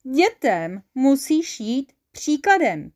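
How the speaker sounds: lightly squeaked, drawn-out retort; slow, high squeal of an angel. A narrator's reading. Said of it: "pištěla anděl".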